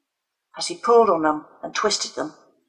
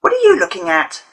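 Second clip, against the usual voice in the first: sharp high challenge, much higher and louder; demanding; 5 dB louder than usual. A narrator's reading. Leo challenging the girl who interrupts, aggressive. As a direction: snap it at the intruder. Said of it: "Leo demanding".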